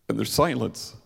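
usual speaking voice

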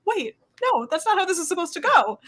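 high-pitched retold protest; upset disbelief. Recalling her reaction to Lewis going home, upset. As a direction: pitch it way up, refusing to accept it. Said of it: "I got very upset".

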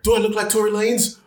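high-pitched comic alarm; replayed worried outburst; retold panicked question. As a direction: ask it high, in mock panic.

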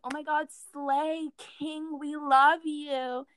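drawn-out, gushing mimicry; mock gushing fan. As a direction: stretch out the vowels in mock adoration.

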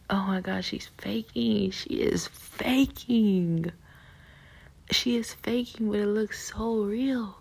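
reenacted scoffing disbelief, slow and high; mock disbelief. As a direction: stretch it out like a scandalised onlooker. Retold disbelief at crying bride.

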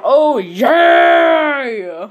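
booming Kool-Aid man Oh yeah; Kool-Aid man yell. Acted. Obama as the Kool-Aid man, loud shout. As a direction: bellow it like bursting through a wall. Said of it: "Obama was like".

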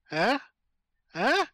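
ordinary delivery

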